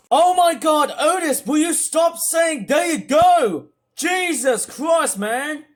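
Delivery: high, loud, exasperated rant; real angry shouting. Real, not acted. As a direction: shout it fed up, drawing out the key words. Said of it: "I'm sorry if I have to sound so angry".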